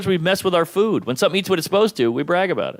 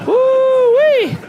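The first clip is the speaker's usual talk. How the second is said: loud, high-pitched "woo-wee"; comic whoop; 8 dB louder than usual. Acted. Comic owning up to his bedwetting, high whoop.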